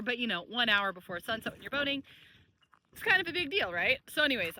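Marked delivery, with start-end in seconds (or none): none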